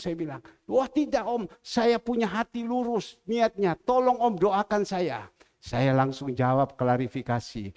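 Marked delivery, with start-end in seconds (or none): 0.7-5.3 high, insistent imitation of pleading; acted-out appeal, pitched up and pressing; retold earnest protest. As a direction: plead your honest intent to an elder. Friend's earnest denial acted out.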